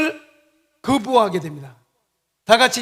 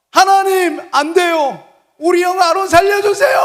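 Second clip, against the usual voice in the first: high, loud desperate cry to God; loud pleading cry as Moses; pleading shout; 8 semitones higher, 10 dB louder than usual. Acted. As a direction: beg God at full voice to spare a brother. Moses pleading for brother in retold scene.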